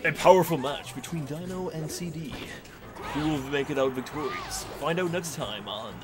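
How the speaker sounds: announcer voice. Pretend. Mock broadcast promo.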